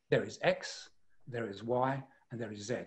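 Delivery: low, quiet, final statements; conclusive. Shown as a demonstration. Expert demonstrating command of the subject, conclusive. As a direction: set out each point with no room for argument. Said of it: "It's very conclusive".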